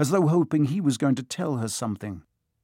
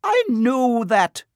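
much higher, slow irritated snap; cross witch, pitched up and louder; irritably; 11 semitones higher, 6 dB louder, slower than usual. Acted. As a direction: bite it off, slowly and higher. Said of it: "said Professor McGonagall irritably".

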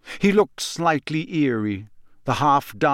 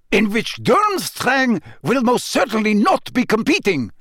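high, furious outburst; exploding; 9 semitones higher, 3 dB louder than usual. A narrator's reading. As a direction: blow up in outrage. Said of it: "exploded Karkaroff".

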